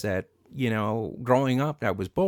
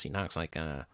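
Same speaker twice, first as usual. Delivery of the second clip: normal talking voice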